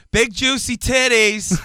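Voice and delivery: high, loud, slow yelled call; mock yell. Guy yelling out at bingo, loud mock yell. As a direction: bellow it like a bingo heckler. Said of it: "the guy yells out".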